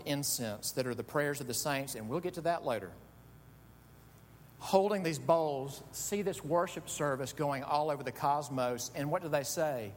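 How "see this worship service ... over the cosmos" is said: usual speaking voice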